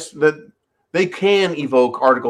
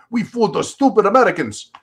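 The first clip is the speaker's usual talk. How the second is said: gloating Russian caricature; 6 semitones higher than usual. Retold or imitated.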